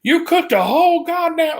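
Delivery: very high, outraged mock yell; cussing boss. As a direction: spit the curse out in disbelief. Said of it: "she cussed at me".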